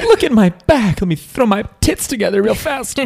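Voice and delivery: high-pitched, hammed-up anime girl voice; put-on high voice mocking a female character.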